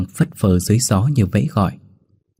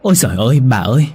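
higher, flustered servant stammering out a question; stammering alarm; 3 semitones higher than usual. A narrator's reading. Servant stammers at her mistress over the dead cat, flustered. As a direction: stumble over the words in shock. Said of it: "lắp bắp hỏi".

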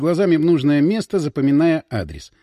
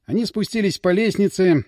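usual speaking voice

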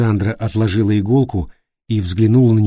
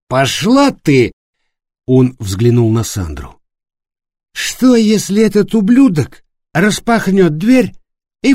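put-on drunken anger; 7 semitones higher, 4 dB louder than usual. A narrator's reading. Флориан пьяно огрызается.

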